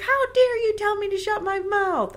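high-pitched, indignant screech; mock outrage. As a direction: play a wife shrieking back, hammed up. Wife's outraged retort in acted quarrel.

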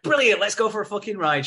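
high, eager retold acceptance; sudden hammed-up enthusiasm; mock delight. She's won over by the car, mock-delighted.

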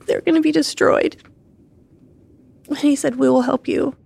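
real tearful retelling. Real, not acted. Widow retelling hearing of death.

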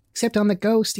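high gleeful shriek, mildly done; a touch of childish shrieking. A narrator's reading. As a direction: squeal it out like playful kids. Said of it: "shrieked the twins".

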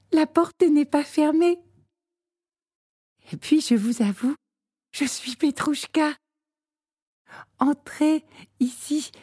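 put-on high, eager little girl asking a question; a small girl calling at the door. A narrator's reading.

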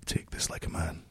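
whispered, low and gentle; quiet whisper. Retold or imitated. Sergeant major whispering advice to a soldier, low and hushed. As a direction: murmur it just for him. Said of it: "really quietly went".

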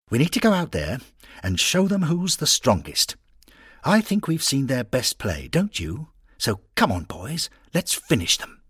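a lightly voiced captain urging his boys on; rallying skipper. Skipper firing up the team for the second half, lightly eager.

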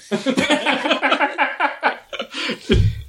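high burst of laughter; big laugh. Real, not acted. Laughing about killing the bottle.

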